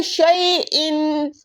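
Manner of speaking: शैइन very slow with the इन fully sounded; proper reading. Shown as a demonstration. Teacher shows the proper hamza reading, very slowly. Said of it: "proper इस इन को पढ़ना है".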